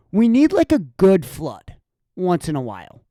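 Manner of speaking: ordinary talk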